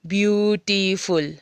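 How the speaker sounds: "ब्यूटीफुल" slowly in three beats, ब्यू-टी-फुल; syllable by syllable. Shown as a demonstration. Teacher showing what syllables are, "ब्यूटीफुल" slowly broken up. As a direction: stretch each syllable of "ब्यूटीफुल". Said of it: "इस चीज़ को syllables बोलते हैं".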